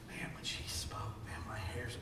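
very soft, voiceless whisper; hushed, breath-only aside; retold whisper. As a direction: imitate someone confiding in a whisper. Retold confiding after the meeting.

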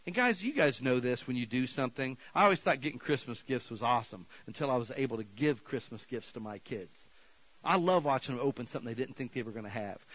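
ordinary talk

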